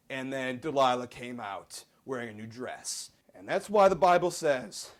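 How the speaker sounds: usual speaking voice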